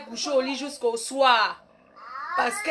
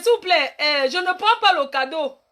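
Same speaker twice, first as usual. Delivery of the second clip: ordinary talk, nothing marked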